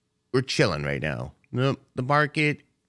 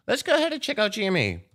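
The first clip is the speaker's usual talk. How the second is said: excited; 11 semitones higher, much faster than usual. Real, not acted. Sudden high excited call.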